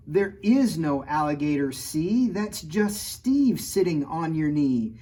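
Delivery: lively picture-book read-aloud; reading to children. A narrator's reading. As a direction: read the rhymes to a kid audience. Reads rhyming picture book.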